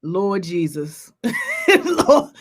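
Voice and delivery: low slow groaning Lord Jesus into a laugh. Real, not acted. Recalling the toe drop, low laughing exclaim. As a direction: laugh through it, can't believe it.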